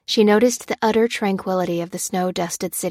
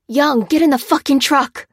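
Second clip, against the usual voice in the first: higher, louder furious yell; screaming; 7 semitones higher, 7 dB louder than usual. A narrator's reading. Jackson screaming at Young to get in the truck, loud. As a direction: scream it at her across the street. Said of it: "screamed Jackson".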